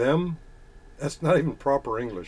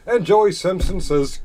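usual speaking voice

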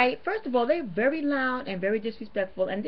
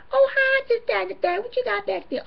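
much higher, bright greeting voice; mock friendly customer; 13 semitones higher, 4 dB louder than usual. Vlogger mimicking a customer greeting the worker, very high. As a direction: squeal a sweet hello at the door.

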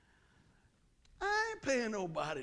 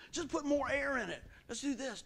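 higher, louder gung-ho suggestion; mock eagerness; 7 semitones higher, 4 dB louder than usual. Church member proposing a quick fix, higher mock eagerness. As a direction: pitch it up, all keen.

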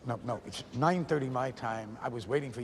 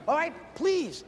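usual speaking voice

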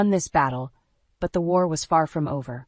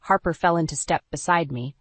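ordinary delivery

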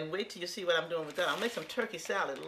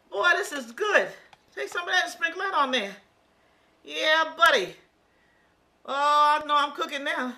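excited; 7 semitones higher, 7 dB louder than usual. Real, not acted. Tasting her pizza topping, delighted.

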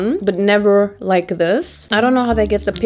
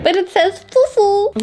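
high funny voice; 12 semitones higher than usual. Acted. Reading odd label in funny voice.

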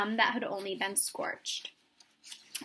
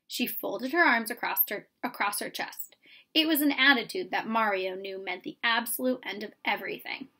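plain reading voice

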